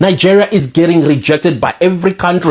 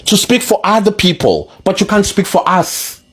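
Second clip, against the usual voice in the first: indignant South Africans; 5 semitones higher than usual. Retold or imitated.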